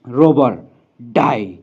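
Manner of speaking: furious command from a distant echoing voice; angry echoing voice. Acted. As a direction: snarl it as if booming out of the barrier. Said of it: "गुस्से से भरी आवाज़ गूंजती है". (गुस्से से भरी, दूर से गूंजती आवाज़)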